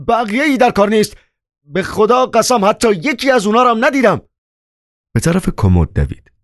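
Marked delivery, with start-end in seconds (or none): 0.1-4.2 very high, helpless pleading; desperate cry. A narrator's reading. Chairman swears he saw no money, desperate cry. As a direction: cry out helplessly at the top of the voice's pitch. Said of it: "از روی درماندگی فریاد زد".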